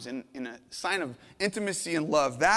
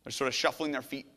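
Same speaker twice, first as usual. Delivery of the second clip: usual speaking voice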